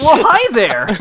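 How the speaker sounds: high, loud laughing prim voice; mock polite greeting. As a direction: chirp a greeting through a laugh.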